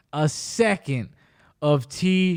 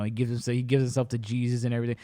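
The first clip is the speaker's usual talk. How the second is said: usual speaking voice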